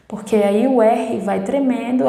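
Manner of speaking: ordinary delivery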